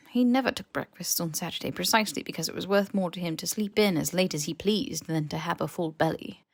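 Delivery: usual speaking voice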